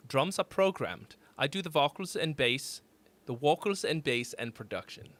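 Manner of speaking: measured German-accented English; German drummer impression. Shown as a demonstration. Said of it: "that was actually my impression of one of my favorite drummers".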